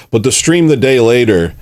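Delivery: ordinary talk, nothing marked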